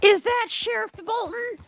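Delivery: high, eager starstruck question; excited. Real, not acted. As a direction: gasp it out like a fan. Fan spots the sheriff.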